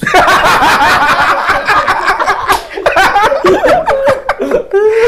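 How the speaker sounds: long, loud, high-pitched laughing fit; real high cackling laughter that keeps going; helpless laughter. Real, not acted. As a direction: burst out laughing at your own punchline. Bursts out at own punchline.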